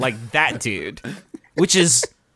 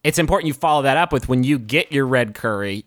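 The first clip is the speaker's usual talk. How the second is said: ordinary talk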